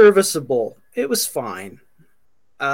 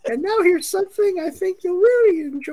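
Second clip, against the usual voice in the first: much higher cartoon squirrel voice; Rocky impression; 11 semitones higher than usual. Quoting Rocky and Bullwinkle, high cartoon squirrel voice. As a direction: chipper cartoon announcer squirrel. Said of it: "Rocky and Bullwinkle".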